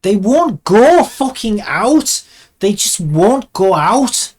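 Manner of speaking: loud, high, slow and emphatic; real exasperation. Real, not acted. Animator fighting arms that won't rotate out, exasperated. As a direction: drag out each word in frustrated anger.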